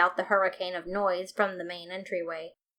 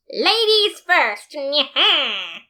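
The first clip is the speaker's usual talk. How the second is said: high, slow, loud courtly flourish; hammy gallant voice; gentlemanly flourish; 7 semitones higher, 8 dB louder, much slower than usual. A narrator's reading. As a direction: sweep her through the door grandly. Papyrus narrator voices himself.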